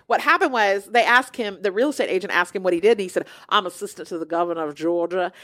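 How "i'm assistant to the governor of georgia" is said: lower, mock Southern official; thick Southern accent. Friend fibbing to real estate agent, lower Southern accent. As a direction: imitate a self-important Southern accent. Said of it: "decided to have a very thick Southern accent".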